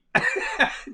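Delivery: high, loud burst of laughter. Real, not acted. Cracking up at her own story. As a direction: explode into laughter.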